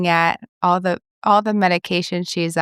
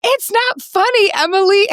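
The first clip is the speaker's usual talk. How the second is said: high, louder protest, playfully imitated; mock indignant scolding; 12 semitones higher, 4 dB louder than usual. Patient scolding her laughing therapist, mock indignation. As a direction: imitate an affronted patient telling someone off.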